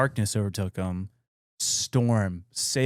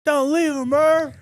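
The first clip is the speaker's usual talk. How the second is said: much higher mock pleading cry; mock movie plea; 4 dB louder, slower than usual. Friend quoting Interstellar's sad ending, mock pleading cry. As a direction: ham up the tearful movie plea.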